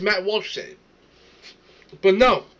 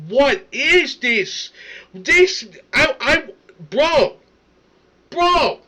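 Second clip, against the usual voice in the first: high, loud, lost-for-words exasperation; exasperated disbelief; 6 semitones higher, 4 dB louder, slower than usual. Real, not acted. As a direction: splutter louder and higher in disbelief. Cannot believe the played clip.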